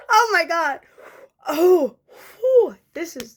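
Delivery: high, loud gasps of real emotion; choked up. Real, not acted. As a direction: blow out the tears before they come. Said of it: "this is from the chest".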